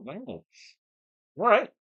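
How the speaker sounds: ordinary delivery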